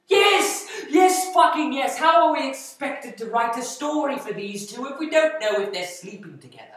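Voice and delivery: high, loud, emphatic outburst; exasperated shout. Acted. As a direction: shout the obvious answer at dim colleagues. Exasperated answer to obvious question.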